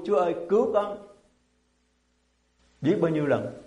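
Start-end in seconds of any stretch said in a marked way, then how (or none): 0.0-1.1 tearful plea. Retold or imitated. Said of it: "quỳ gối xuống khóc than với Chúa".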